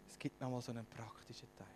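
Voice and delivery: breathy stage whisper of the same line. Shown as a demonstration. Preacher whispers the practical part to the congregation, hushed whisper. Repeats announcement as playful whisper.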